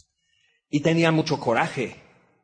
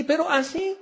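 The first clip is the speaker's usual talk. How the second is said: ordinary talk, nothing marked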